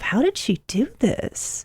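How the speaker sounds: hushed admiring disbelief; amazed wonder. Retold or imitated. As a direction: marvel at it under your breath. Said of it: "And I thought to myself".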